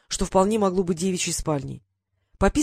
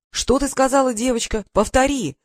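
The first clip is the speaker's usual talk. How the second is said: louder, higher alarmed shout; 5 semitones higher, 4 dB louder than usual. A narrator's reading. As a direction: demand she repeat it, yelling. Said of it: "закричал я".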